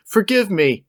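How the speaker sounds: mock apology. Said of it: "Cheadle apologized for his cinematic crime".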